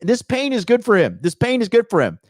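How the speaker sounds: high, loud, insistent cry; emphatic insistence. Real, not acted. Streamer insisting the heartbreak will help him, emphatic. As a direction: drive it home, pitched right up. Emphatic repeated verdict on his suffering.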